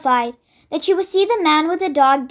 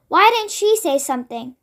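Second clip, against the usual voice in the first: ordinary delivery, nothing marked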